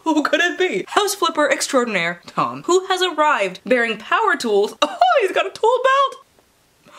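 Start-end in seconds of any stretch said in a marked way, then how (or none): 4.8-6.9 high, slow gushing squeal over the tool belt; giddy squeal. Real, not acted. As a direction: squeal with delight. Thrilled at hero's tool belt.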